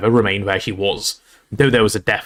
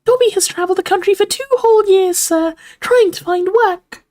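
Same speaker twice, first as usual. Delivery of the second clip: squeaking house elf; 4 dB louder than usual. A narrator's reading. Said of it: "Dobby squeaked".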